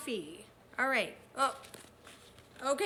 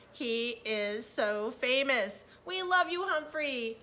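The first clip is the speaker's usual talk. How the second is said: ordinary delivery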